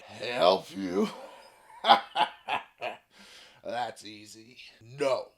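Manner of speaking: very low, mocking dragon laugh. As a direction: laugh low and refuse him. Dragon mocks and refuses.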